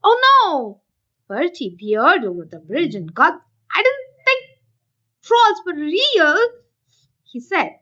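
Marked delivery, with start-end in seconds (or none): 0.0-0.8 high, loud gasp of alarm; a goat's startled shout; startled cry. A narrator's reading. Goat sees troll. 3.8-6.4 surprised goat. A narrator's reading.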